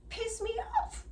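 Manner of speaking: high, hammed-up indignant burst; mock-furious exclamation; acted-out anger. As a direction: play up the outrage. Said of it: "I'm acting out here".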